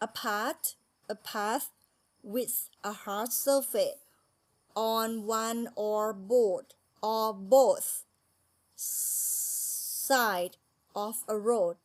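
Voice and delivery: very slow, word by word, each word separated; slow careful reading. Shown as a demonstration. Teacher reading the English definition to Thai students, very slowly. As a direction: read the definition word by word for learners. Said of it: "reading definition aloud for class".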